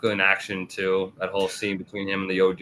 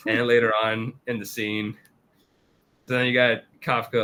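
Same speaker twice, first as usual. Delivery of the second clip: ordinary talk, nothing marked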